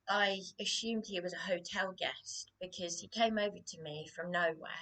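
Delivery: normal talking voice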